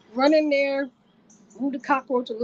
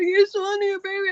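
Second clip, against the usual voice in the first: high, loud mock-crying cry; wailed news to a partner, pitched way up; tearful announcement; 11 semitones higher, 4 dB louder than usual. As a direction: call out through pretend tears. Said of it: "So I'm crying".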